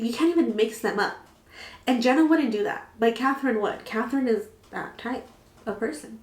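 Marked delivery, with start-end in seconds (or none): none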